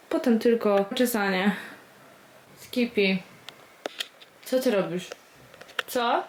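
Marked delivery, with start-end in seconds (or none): none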